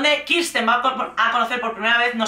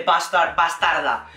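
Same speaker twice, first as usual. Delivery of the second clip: low, mock-unhinged insult; a lightly imitated fiancé losing it; 5 semitones lower than usual. Retelling the fiancé insulting her, low and mock-furious.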